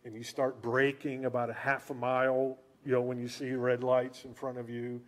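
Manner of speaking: usual speaking voice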